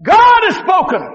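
high, slow, ringing declaration; drawn-out preacher's cry; prophetic proclamation. Acted.

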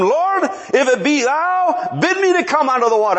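eager high-pitched plea, stretching the first words; exuberant. Acted. Peter calling to Jesus from the ship, exuberant. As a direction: call out full of zealous faith. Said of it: "he's just exuberant".

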